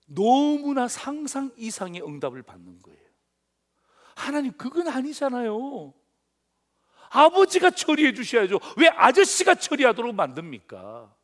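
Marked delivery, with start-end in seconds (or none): 4.1-11.2 protesting. Acted. Habakkuk protests to God.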